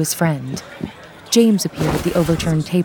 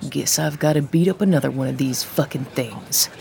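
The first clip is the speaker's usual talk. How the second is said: tough guy voice; 5 semitones lower, 4 dB quieter than usual. Acted. Tough guy ready to fight.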